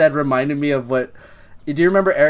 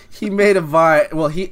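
ordinary talk, nothing marked

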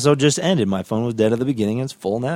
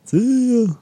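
silly high voice; 13 semitones higher than usual. Acted. Signing off in silly voice.

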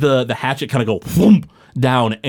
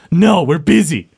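curt brush-off; 5 semitones higher, 5 dB louder than usual. Acted. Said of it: "He goes".

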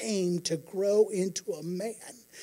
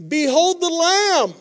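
loud, high, drawn-out shout; yelled proclamation; 9 semitones higher, 9 dB louder, slower than usual. Retold or imitated. John the Baptist announcing Jesus as he approaches, yelled out. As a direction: yell it out slowly for a crowd to hear. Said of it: "He yells out".

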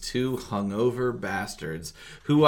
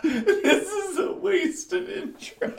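laughing; 7 dB louder, much slower than usual. Real, not acted. Host cracks up at blunder.

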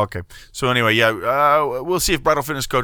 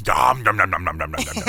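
Cookie Monster; 5 semitones lower than usual. Acted. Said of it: "Cookie Monster".

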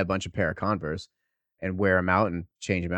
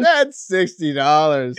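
teasing insistence; 5 semitones higher, 6 dB louder, much slower than usual. Real, not acted.

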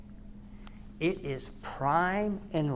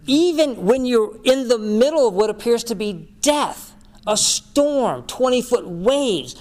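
loud, higher, driving sermon build; preaching at full force; 7 semitones higher, 9 dB louder than usual. Acted. Pastor preaching the storm story, loud and rising. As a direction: preach it out big to the room.

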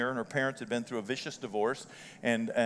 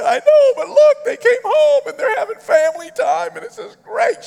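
very high, loud blubbering imitation; mock crying; 15 dB louder than usual. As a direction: wail it like a hammed-up sentimental grandpa. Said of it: "Are you gonna cry?".

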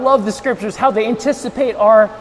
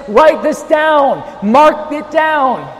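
"Write this down" raised and drawn out; emphatic command; 4 semitones higher, much slower than usual. Acted. Preacher stressing what he says from the throne, commanding. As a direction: stretch "mark it down" slowly at a higher pitch. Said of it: "note that he says".

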